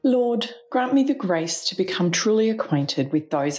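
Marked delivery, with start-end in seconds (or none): none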